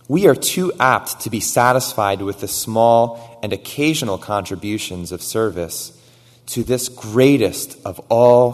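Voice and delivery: loud, building sermon reading; reading an old sermon aloud to the congregation, loud and fervent; preaching at full force. Acted. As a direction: proclaim it with rising fervour. Reads Miller's sermon quote.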